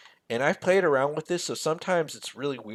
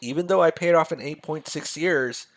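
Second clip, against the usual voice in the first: ordinary talk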